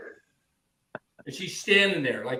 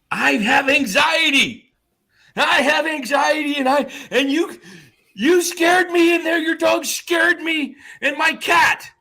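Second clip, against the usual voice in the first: high, loud, frantic mock shouting; anxious yelling; 13 semitones higher, 7 dB louder than usual. Neighbor shouts about her anxiety at the dog owner, high frantic yelling. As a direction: wail it like a panicked neighbor. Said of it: "she says".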